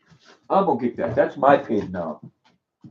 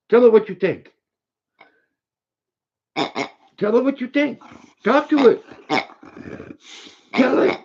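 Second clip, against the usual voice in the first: much higher, rising urging; pet-talk climbing higher and higher; high excited dog-talk; 10 semitones higher than usual. Real, not acted. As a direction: egg the dog on at the toy. Urging dog to bark at pig.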